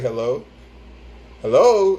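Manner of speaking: calling down the line, losing patience; fed-up hello. Retold or imitated. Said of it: "the fourth time I say it".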